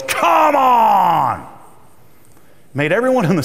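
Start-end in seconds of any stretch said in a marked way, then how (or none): 0.0-1.5 high, loud, drawn-out yell; angry yell. Retold or imitated. Impatient customer yelling at the cashier, loud yell. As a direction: bark it at the whole store. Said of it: "the cleaning supply lady yells".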